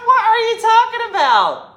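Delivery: high, loud, outraged what are you talking about; loud shocked retort; shrieking disbelief. Retold or imitated. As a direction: shriek it back at her. Retold outraged reply.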